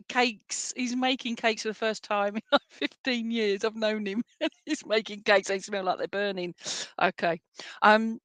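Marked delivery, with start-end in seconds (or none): 4.4-5.5 laughing. Real, not acted. Laughing about partner baking.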